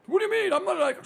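high, put-on protesting voice; mock whiny denial; indignant other guy. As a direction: mimic a flustered objection. Acting out the offended other man.

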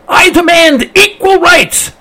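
high, drawn-out goose protest; hammed-up, high-pitched demand; mock yell. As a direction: yell it slowly as an indignant goose. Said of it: "The goose yelled".

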